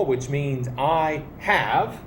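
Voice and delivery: ordinary talk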